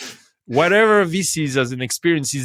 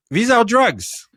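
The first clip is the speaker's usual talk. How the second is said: high, loud, pressing the point hard; emphatic insistence; 12 semitones higher, 6 dB louder, slower than usual. Real, not acted. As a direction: drive the point home. Insisting that habits are drugs.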